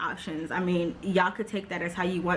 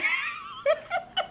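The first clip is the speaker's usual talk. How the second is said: high, squealing burst of laughter; real laugh. Real, not acted. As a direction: crack up at your own story. Laughs at own retelling.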